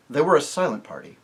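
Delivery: ordinary delivery, nothing marked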